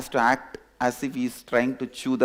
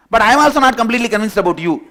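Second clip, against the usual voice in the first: fast, high, loud outburst; loud blurted confession; 8 semitones higher, 10 dB louder, much faster than usual. Acted. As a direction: blurt it out in one rushed burst. Cub blurts out doubt to lion.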